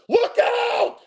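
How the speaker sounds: high, loud panicked yelp; mock yell. Streamer mocking blockers who yell warnings, high panicked yelp. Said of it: "you yell".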